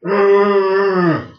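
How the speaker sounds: loud high groaning arr; dead man's moan. Retold or imitated. Undertaker imitating the dead moaning arr. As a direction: moan like a corpse. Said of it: "But they go".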